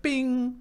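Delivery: very high bright ding; bell sound. Retold or imitated. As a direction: imitate a button chime. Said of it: "it goes like".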